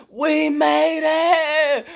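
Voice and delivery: high, slow, loud cheer; excited scream. Retold or imitated. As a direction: yell it out in triumph. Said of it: "we was screaming like".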